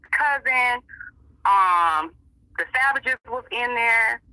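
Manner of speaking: ordinary talk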